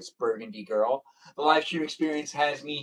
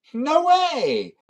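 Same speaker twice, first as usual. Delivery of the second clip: high, loud exclamation; mock disbelief; 12 semitones higher, 7 dB louder than usual. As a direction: cry out in exaggerated surprise.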